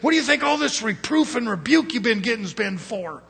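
high, loud run of reproach; imagined rebuke. Pretend. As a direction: mimic a mentor scolding with a rhetorical question.

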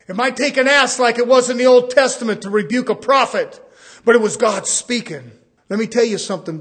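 loud, high burst of preaching. Real, not acted. Preacher insisting God speaks through anyone, loud preaching. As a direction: ring it out from the pulpit.

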